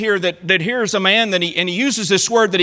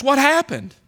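higher, pleading question; a hint of a bewildered lament; bewildered cry; 7 semitones higher than usual. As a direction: ask as if lost. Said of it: "it was almost like this".

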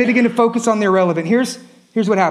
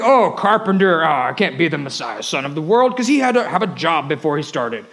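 mocking doubter; 7 semitones lower than usual. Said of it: "You're like".

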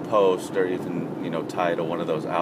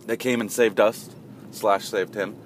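plain reading voice

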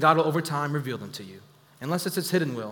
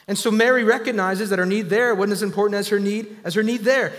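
raised, louder preacher's build; preaching; 3 semitones higher, 4 dB louder than usual. Acted. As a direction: preach it out with fire. Preaching at full force.

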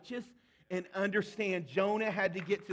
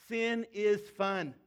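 slow, deliberate, louder statement; slow emphatic; 4 dB louder, much slower than usual. Real, not acted. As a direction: drop it slowly for effect. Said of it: "I need you to hear this".

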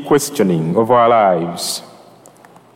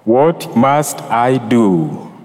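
very slow, weighty; much slower than usual. Real, not acted. Priest to his congregation, slow and weighty. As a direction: draw out "What must I do" slowly.

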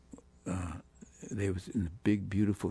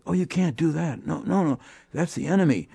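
alarmed forbidding; 7 semitones higher, 4 dB louder than usual. Retold or imitated. French critics forbid visiting [name].

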